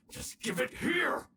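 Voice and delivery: high, sharp snapping demand; angry demand. Acted. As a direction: grab it back in fury. Villain demands charm back.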